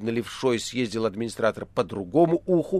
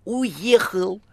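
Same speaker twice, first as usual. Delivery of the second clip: high phone-caller cry; theatrical high exclamation; 10 semitones higher, 4 dB louder than usual. A narrator's reading. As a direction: call it out in a high put-on voice. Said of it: "кричала трубка".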